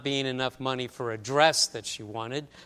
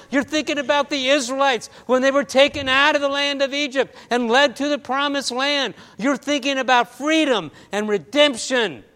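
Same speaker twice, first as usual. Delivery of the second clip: higher, louder, fervent pulpit delivery; preaching; 8 semitones higher, 6 dB louder than usual. Acted. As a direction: preach it out to the congregation, building. Preacher rises into full delivery.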